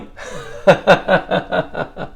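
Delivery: laughing at his own punchline; real laugh. Real, not acted. Speaker laughs at own joke.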